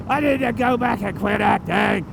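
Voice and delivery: hammed-up despairing whine in a strained voice; paranoid meltdown. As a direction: overact giving up in total panic. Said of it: "you get paranoid".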